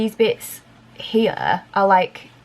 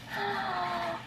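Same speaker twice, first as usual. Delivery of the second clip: sharp gasp; 12 semitones higher, 13 dB quieter than usual. Acted. Mock surprise at viewers.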